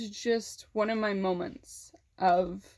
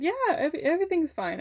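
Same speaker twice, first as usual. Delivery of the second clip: lightly acted, high-pitched reassurance; covering up upset; 8 semitones higher than usual. Niece reassuring her worried aunt on the phone, mildly covering up. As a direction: insist a little too high that all is well.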